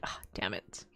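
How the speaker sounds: whispered resigned curse under the breath; muttered frustration. Retold or imitated. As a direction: sigh it out almost voiceless. Retold dismay at lingering headache.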